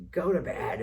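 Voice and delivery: put-on high, loud bark at the kids; angry order. Father ordering the kids to bed, loud and angry. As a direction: send them to bed sharply.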